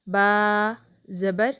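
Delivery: "बा ज़बर" slow, बा held long; drawn-out Qaida drill; letter drill. Shown as a demonstration. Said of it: "जैसे हम ज़बर लगाते हैं". ("बा ज़बर" धीरे, बा लंबा)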